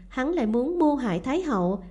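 ordinary delivery, nothing marked

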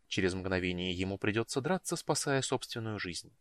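plain reading voice

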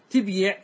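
تبيع pronounced tib-yiʕ with no vowel after b; vowelled ya. Shown as a demonstration. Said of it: "تحركت الياء". (تبيع بياء مكسورة بعد باء ساكنة)

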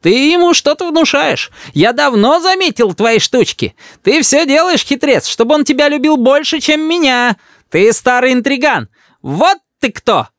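high, sustained jealous tirade; accusing cry. A narrator's reading. Grandmother denounces grandfather as schemer, angry. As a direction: keep accusing him at high pitch. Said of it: "кричит бабушка дедушке".

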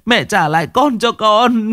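high, sobbing plea stressing con; a mother begging her mother-in-law, high and tearful. Acted. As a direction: beg for the child back. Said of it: "mếu máo".

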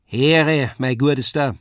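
lightly voiced Saxon dialect; Leipzig dialect. A narrator's reading. Tailor's journeyman to his neighbour at the show, Saxon dialect. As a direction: speak up in homely Leipzig Saxon.

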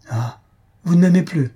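despairing cry. A narrator's reading. Mario to Faustine, fearing lost love, despairing. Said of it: "m'écriai-je avec désespoir".

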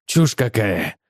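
low-pitched, curt dismissal; mildly irritated retort; snappish retort. A narrator's reading. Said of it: "огрызнулся Брин".

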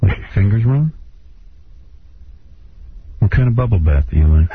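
low, coaxing lover's voice; seductive voice. Acted. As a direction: coax her gently, hamming it. [name] playing seductive lover.

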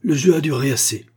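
colère contenue, plus aiguë et plus forte; fureur concentrée. A narrator's reading. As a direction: gronde sa fureur sans exploser. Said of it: "avec une fureur concentrée". (l'adversaire se lève en sursaut, fureur concentrée)

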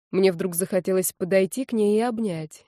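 plain reading voice

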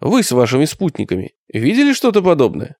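ordinary delivery, nothing marked

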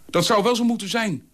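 hoog en luider brullen; brullend. A narrator's reading. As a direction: brul het uit. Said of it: "brulde Dolf". (brullende Dolf)

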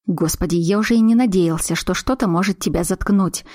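ordinary delivery, nothing marked

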